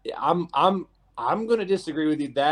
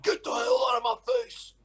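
high shouted mock tough guy; imitating an aggressive bouncer, hammed-up shout; faster than usual. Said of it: "It's like".

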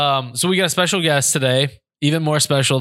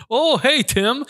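high-pitched mock surprise; startled greeting; 12 semitones higher, slower than usual. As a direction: imitate a man startled by a hidden acquaintance. Dog walker startled by hunter, retold.

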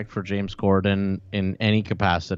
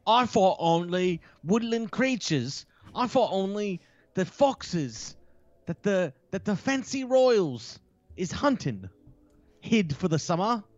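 high, slow, prissy mock reading; high slow mock of a tabloid columnist; mocking posh tabloid voice; 10 semitones higher, 5 dB quieter, slower than usual. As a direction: parody the snooty article writer. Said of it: "Isn't that fun?".

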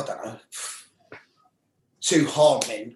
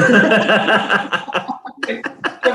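high loud laugh; 7 semitones higher, 7 dB louder than usual. Real, not acted. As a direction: burst out laughing. Laughing at the memory.

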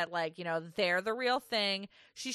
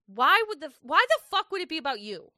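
louder, fast exasperated rant; indignant rant; 6 semitones higher, 7 dB louder, faster than usual. Real, not acted. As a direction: rant at him in disbelief. Ranting at Keenan.

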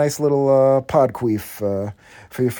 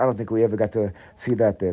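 ordinary talk, nothing marked

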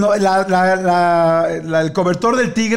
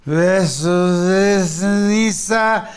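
slow-motion quote; much slower than usual. Retold or imitated.

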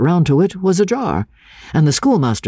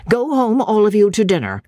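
stern schoolmaster, higher pitch; strict teacher; 10 semitones higher than usual. Acted. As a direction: order the boys out sternly. Schoolmaster dismisses boys.

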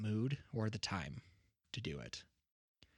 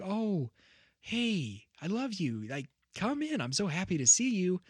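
high-pitched acted welcome, louder at the end; surprised, happy parent; 8 semitones higher, 5 dB louder than usual. Parent opening the door to a long-unseen child, happily surprised. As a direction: gush like a parent overjoyed at the door. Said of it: "you're surprised they're there".

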